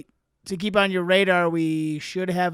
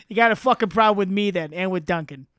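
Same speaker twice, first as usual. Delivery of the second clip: belligerent, badly faked Boston accent; loud Boston tough talk, played for laughs; mock Boston accent; 8 dB louder, faster than usual. Friend joining in as a combative fan, mock Boston accent. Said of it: "I don't like yours either".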